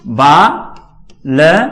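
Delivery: drawn out word for the board; slow dictation. Shown as a demonstration. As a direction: stretch each syllable as you write. Teacher dictating while writing.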